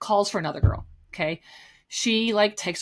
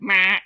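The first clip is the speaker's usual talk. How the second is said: loud, harsh buzzer imitation; mean error beep; 6 dB louder than usual. Customer showing the register's error sound, loud mean beep. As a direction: blurt a buzzing error tone. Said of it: "It was like that".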